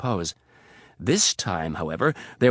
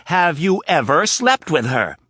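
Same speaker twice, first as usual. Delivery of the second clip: slow, loud, stress on slept; insistent repeat; 7 semitones higher, 8 dB louder than usual. Acted. Anna poking Rapp's chest, repeating it slower with stress on slept. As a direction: hammer the word slept.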